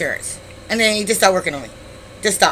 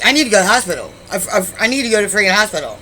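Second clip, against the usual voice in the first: higher, louder, stuttered insistence; urgent demand; 4 semitones higher, 5 dB louder, much faster than usual. Retold or imitated. Shot woman to boyfriend, urgent insistence. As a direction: act out demanding help right away.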